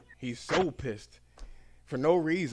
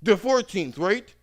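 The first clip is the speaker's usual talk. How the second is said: irritated customer; 8 semitones higher, 9 dB louder, slower than usual. Retold or imitated. Customer confused.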